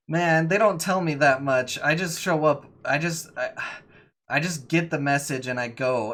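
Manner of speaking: cornered lackey insisting he is unimportant; pleading captive. Acted. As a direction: plead ignorance to the interrogators, exasperated. Plays captive under questioning.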